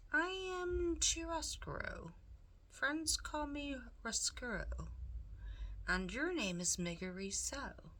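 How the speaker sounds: Roscoe the rat. Acted. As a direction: purr it like a sly, charming villain. Roscoe introduces himself.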